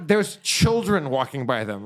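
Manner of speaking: ordinary talk, nothing marked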